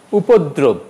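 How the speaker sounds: উপদ্রব run together, "upoddrob"; joined-up উপদ্রব; wrong pronunciation. Shown as a demonstration. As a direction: say উপদ্রব without a break. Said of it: "উপদ্রব হবে না".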